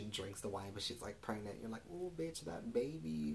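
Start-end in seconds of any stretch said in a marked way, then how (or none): 1.9-3.3 high-pitched scandalized ooh; gasping gossip, pitched up in mock dismay; mock alarm. Viewer reacting to pregnant woman drinking wine, mock alarm.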